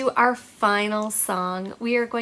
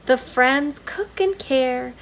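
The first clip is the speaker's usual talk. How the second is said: gentle storybook read-aloud; reading to children; 6 dB louder, slower than usual. Acted. Teacher reading a picture book to her class, gentle read-aloud. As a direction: read it to little ones, unhurried.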